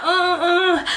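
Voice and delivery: high strained pushing grunts. Retold or imitated. Mother pushing on the midwife's command, high strained grunts. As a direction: mimic straining to push. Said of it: "So I'm like".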